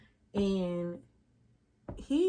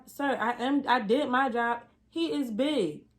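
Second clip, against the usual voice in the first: louder, higher protest; defensive; 5 semitones higher, 5 dB louder, slower than usual. Retold or imitated. Assistant defending herself to the doctor, loud protest. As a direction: push back hard.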